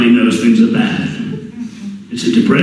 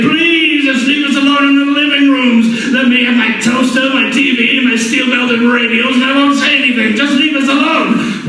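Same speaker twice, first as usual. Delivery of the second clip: high, whiny plea to be left alone; a hint of a timid homebody; pleading citizen; 11 semitones higher than usual. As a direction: beg like a scared shut-in. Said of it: "and we say".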